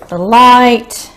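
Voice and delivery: normal talking voice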